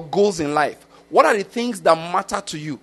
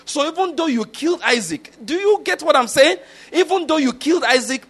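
rising fervour; 8 semitones higher than usual. Real, not acted. Preacher driving his point home to the congregation, fervent and high. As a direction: lift your voice as the point peaks.

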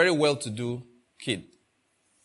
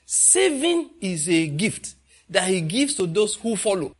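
ordinary delivery

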